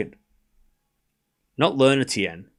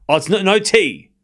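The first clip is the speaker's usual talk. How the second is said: ordinary delivery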